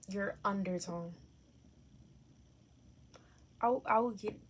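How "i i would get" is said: stuttering on I, I; stumbling stutter on I. Real, not acted. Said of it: "I'm sorry, I'm stuttering".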